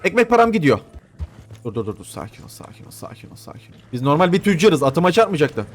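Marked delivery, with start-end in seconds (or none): 1.6-3.8 hushed steady whisper; breathless whispered reassurance; whispered calming. Real, not acted. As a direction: whisper soothingly to the horse. Calming the horse again.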